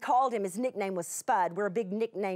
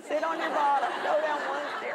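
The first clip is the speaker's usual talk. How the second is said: mock mother instructing; 5 semitones higher, faster than usual. Telling little girl how to go downstairs.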